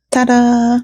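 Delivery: high sing-song ta-da; playful ta-da. Pretend. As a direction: present it with a flourish. Playful reveal flourish.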